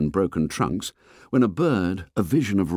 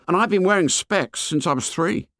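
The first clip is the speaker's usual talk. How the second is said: put-on fat boy voice; 10 semitones higher than usual. A narrator's reading. Fat boy complains to the fair boy, high and whiny. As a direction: complain like a chubby schoolboy.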